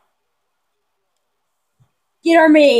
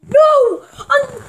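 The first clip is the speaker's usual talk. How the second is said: dismayed shout; 11 semitones higher than usual. Real, not acted. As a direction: shout no at the flag. Reacting to a penalty flag.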